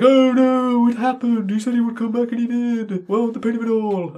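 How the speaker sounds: high, whining mock lament; mock Frankenstein wail. Mocking Frankenstein over his dead wife, high whining wail. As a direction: wail it like a melodramatic victim. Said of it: "he's like".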